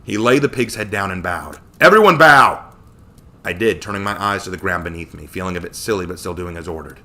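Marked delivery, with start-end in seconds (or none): 1.8-2.6 loud, high barked order; shouted command. A narrator's reading. Leader orders the group down before an offering, shouted command. Joshua orders everyone to bow.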